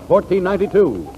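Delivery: ordinary talk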